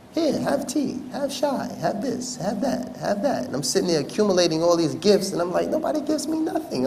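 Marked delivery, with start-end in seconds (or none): 0.1-3.5 high-pitched, insistent host; imitating a host pressing gifts on a guest; eager host impression. 5.7-6.9 high, comic near-crying voice; hammed-up weepy squeak; mock tearful. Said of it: "I'm just ready to cry".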